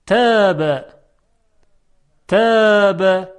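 تاب with a light, thin t and fronted vowel; careful model of تاب with unemphatic ت; light dotted ta. Shown as a demonstration. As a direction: draw out تاب, keeping the ت fine and delicate. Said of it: "با ت دو نقطه".

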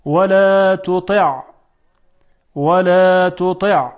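careful model of ولا تطع ending on a crisp ع; difficult ta drill. Shown as a demonstration. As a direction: recite ولا تطع with a long held ولا. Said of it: "تلفظ اینها مقداری".

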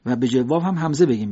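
ordinary delivery

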